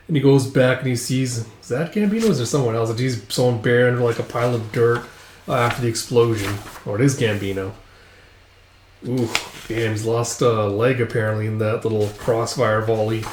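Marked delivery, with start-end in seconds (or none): none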